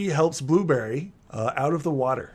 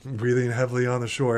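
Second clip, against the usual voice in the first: usual speaking voice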